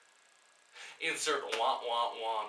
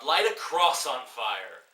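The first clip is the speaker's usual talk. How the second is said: scornful mock; 9 dB louder, slower than usual. Real, not acted. Mocking the film.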